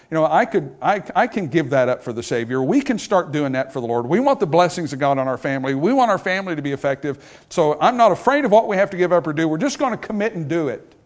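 rally the congregation with rising conviction; preaching at full force. Acted. Preacher urging his congregation to commit, loud rising fervor.

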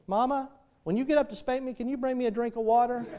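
put-on high, soft child's voice; little girl. Little girl asks mama despite threat of spanking, high soft imitation. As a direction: imitate a small girl sweetly asking mama. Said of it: "that little girl".

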